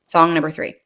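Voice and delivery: usual speaking voice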